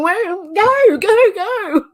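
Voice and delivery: high, loud, drawn-out holler; hammed-up impatient driver's yell; exasperated yell. Driver urging someone who just sits there, exasperated yell. Acts out yelling at a stalled driver.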